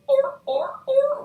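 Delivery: very high, loud repeated yelp; imitated animal call. Tarot reader guessing at an animal, high imitated call. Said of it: "otter? An otter?".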